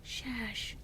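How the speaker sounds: high whispered goblin shush. Acted. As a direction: hush the others sharply. Goblin hushes others.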